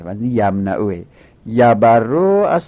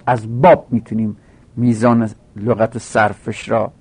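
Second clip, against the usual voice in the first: ordinary talk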